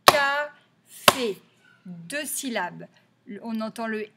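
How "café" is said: Café loud and higher, a gap between CA and FÉ; syllable by syllable. Shown as a demonstration. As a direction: tap out Café in two strong beats. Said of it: "on le tape".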